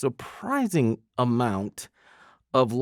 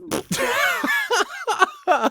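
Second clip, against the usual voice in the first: mock child giggle. Son cracking up.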